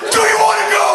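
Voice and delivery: high, loud mock challenge from a pumped-up fan; aggressive fan. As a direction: bark it like a drunk football fan itching to fight. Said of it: "I'm like".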